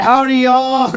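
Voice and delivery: high hammed-up Southern howdy; mock cowboy greeting, drawn out; imitating a country singer; country accent. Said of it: "singing Break Stuff in a country accent".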